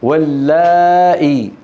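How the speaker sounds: slow واللائي with a greatly stretched laa; long alif. Shown as a demonstration. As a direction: hold the aa in واللائي before the hamza. Demonstrates the long alif vowel.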